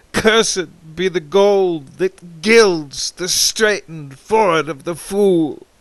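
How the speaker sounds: ordinary delivery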